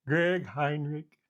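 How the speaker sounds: slow drawn-out Lou Holtz call of the name. Retold or imitated. Coach calling the kicker back out, slow and drawn out. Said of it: "best Lou Holtz voice".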